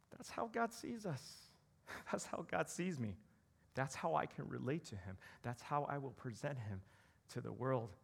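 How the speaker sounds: ordinary delivery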